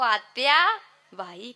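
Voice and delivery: "आत्या बाई" stretched out slowly; drawn out. Shown as a demonstration. Kirtankar shows women how long this title takes, drawn out. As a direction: take a long time over "आत्या बाई". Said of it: "किती वेळ लागतो".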